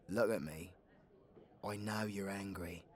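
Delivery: soft, hushed reassurance; quiet, intimate plea; gentle calming. Acted. Calming an angry companion.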